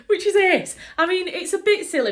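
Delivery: high, loud laugh into the words; gleeful laughing exclamation; laughing delight. Real, not acted. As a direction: laugh out your delight at the news. Delighted at Sean Bean casting.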